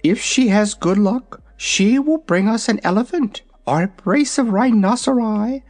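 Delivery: high, proud little dragon; young dragon voice. Acted. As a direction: talk like a vain baby dragon. Dragonette speaks.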